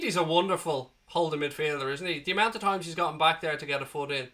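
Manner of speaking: normal talking voice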